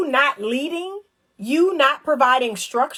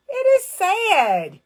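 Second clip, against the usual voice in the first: high, slow mock mournful sad; exaggerated pity; 9 semitones higher, much slower than usual. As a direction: stretch it like a sigh of fake grief. Mock lament after story.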